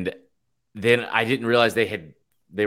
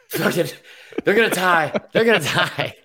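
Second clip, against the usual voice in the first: mock caller; 10 semitones higher than usual. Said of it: "called back one more time and be like".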